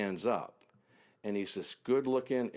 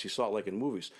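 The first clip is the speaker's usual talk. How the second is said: ordinary talk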